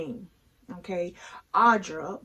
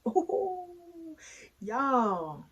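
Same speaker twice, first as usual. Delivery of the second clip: excited ooh; 8 semitones higher, 3 dB quieter than usual. Real, not acted. Gushing over handsome Randall.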